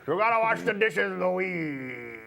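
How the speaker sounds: lightly voiced pirate; a hint of a bossy pirate. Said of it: "[name]'s a pirate".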